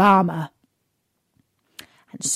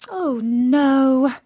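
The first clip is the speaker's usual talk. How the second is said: sad princess; 5 semitones higher, 4 dB louder than usual. Acted. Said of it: "Princess Beatrice was very sad".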